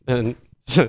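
usual speaking voice

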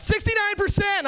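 high yelled shout of a number; heckler's yell. Retold or imitated. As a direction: bark it from the back of the room. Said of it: "somebody yelled out".

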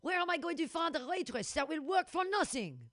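hammy French chef begging his daughter; grumpy French chef. Acted. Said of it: "grumpy French chef".

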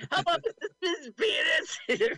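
high, loud, laughing prank-call voice; squeaky woman's voice through laughter; lady voice. Retold or imitated. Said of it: "lady voice today".

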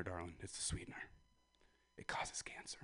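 soft whispered aside. Real, not acted. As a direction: whisper it to the crowd as a secret. Whispered mock secret.

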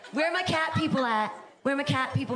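normal talking voice

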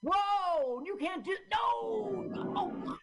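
very high, drawn-out cry of protest; high-pitched mock outrage; mock alarmed yell. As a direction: yell in hammed-up horror. Mock outrage at film's action.

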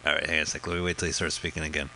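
usual speaking voice

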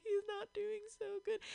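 high, mock-tearful whimper; imitating his crying. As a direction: replay the tearful plea lightly. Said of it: "crying, and I'm like".

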